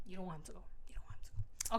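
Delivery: hushed, sheepish admission; confiding whisper. Real, not acted. Reader admitting she likes Reese, confiding whisper. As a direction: drop to a whisper, confessing.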